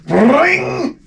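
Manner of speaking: very high ringing trill; imitated prickling hairs. Comic voicing his neck hairs rising, high ring. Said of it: "hairs on the back of my head were like".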